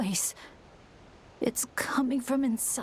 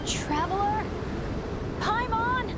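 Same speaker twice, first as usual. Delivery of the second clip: calling out; 10 semitones higher than usual. Acted. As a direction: call into the emptiness for friends. Alone, calling for companions.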